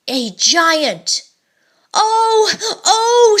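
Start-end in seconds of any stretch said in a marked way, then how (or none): 1.9-3.4 high, loud cry of fright; frightened scream. Acted. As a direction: shriek it like scared boys. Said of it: "screamed the three boys".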